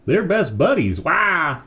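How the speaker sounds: high duck squawk; squawking duck puppet. Acted. Duck puppet squawking at the ventriloquist. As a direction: quack it out like an angry toy duck.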